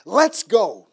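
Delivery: loud, high call to follow; rally the boys out the door; loud let's go; commanding call. Retold or imitated. Taking charge, leading boys out.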